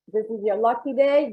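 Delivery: ordinary delivery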